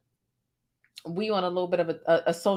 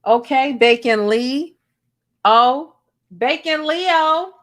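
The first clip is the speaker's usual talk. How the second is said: calling the dog; 5 semitones higher, 9 dB louder, slower than usual. Real, not acted.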